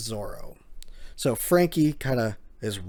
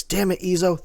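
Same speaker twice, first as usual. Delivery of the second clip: higher, louder bitter curse; 7 semitones higher, 5 dB louder than usual. Retold or imitated. Wounded agent curses Izo, loud and bitter. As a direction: spit out the name in anger.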